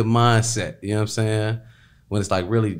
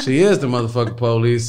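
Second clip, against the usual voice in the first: laughing; 3 semitones higher, 7 dB louder than usual. Real, not acted. Laughing emphatic punchline.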